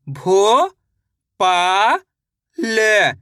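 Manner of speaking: अ, नो, खा drawn out separately, the first two rising; syllable by syllable. Shown as a demonstration. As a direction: sound out अ, नो, खा with long gaps. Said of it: "एक अक्षर का उच्चारण सही से". (अ, नो, खा अलग-अलग खींचकर)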